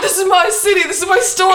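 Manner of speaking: high, loud laughing yell; screaming. Retold or imitated. Filming herself behind the counter, gleeful screaming. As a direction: shout it like you own the city. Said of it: "I start screaming".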